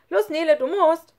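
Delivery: high, loud teasing call; playful shout. Acted. Schlunz tagging Nele and running away, shouting playfully. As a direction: yell it while running off. Schlunz starts a game of tag.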